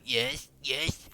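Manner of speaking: high-pitched put-on character voice; quoting a game character in a high voice; imitated voice line. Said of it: "what is that voice line from?".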